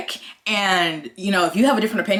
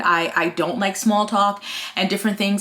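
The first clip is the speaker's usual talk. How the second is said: normal talking voice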